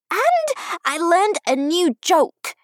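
put-on high, loud, slow child voice; puffed-up child, drawn out; proud little girl. A narrator's reading. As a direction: announce it like a boastful five-year-old. Child proudly boasts to her dad.

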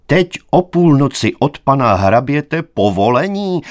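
loud, high, incredulous outcry; indignant exclamation. A narrator's reading. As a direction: cry out in disbelief at the idea. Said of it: "zvolal mladík". (pohoršené zvolání)

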